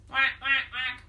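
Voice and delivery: ordinary delivery, nothing marked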